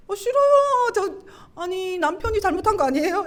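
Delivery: whiny refusal. Retold or imitated. Wife refusing God's order to apologize, whiny protest.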